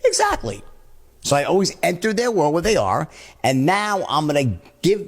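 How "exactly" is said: "Exactly." said sure and emphatic, pitched higher, falling at the end; confident, higher "Exactly."; more certain. Shown as a demonstration. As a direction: sound more certain than them. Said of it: "I'll sound more certain myself".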